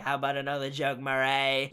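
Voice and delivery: goofy but scary low villain voice; Joaquin Phoenix's Joker. Retold or imitated. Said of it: "the talk show scene between him and Murray".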